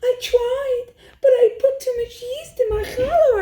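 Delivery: high, teary wail of confession; crying. A narrator's reading. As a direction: sob out the excuse to Bobby. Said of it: "I cried".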